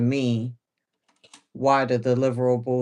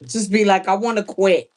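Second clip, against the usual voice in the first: mock exasperation; 8 semitones higher, 7 dB louder than usual. Imagining quitting her job.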